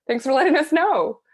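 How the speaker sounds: high, loud, polite acknowledgement; acted-out reply from surprised relatives; imitated taken-aback thanks. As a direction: raise the voice into startled politeness. Others' relieved reply, retold.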